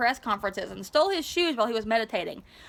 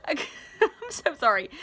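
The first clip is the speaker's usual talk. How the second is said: giggling through an apology; can't stop laughing, sorry; 3 semitones higher than usual. Real, not acted. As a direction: reader breaking up in giggles mid-story.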